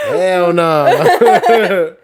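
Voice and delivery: loud laughing refusal. Real, not acted. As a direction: bark it out through laughter.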